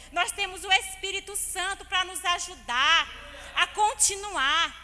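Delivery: loud, insistent exhortation with punched phrase endings; forceful preaching. Real, not acted. Preacher urging the church that they already have everything, forceful preaching. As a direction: proclaim it to the whole congregation.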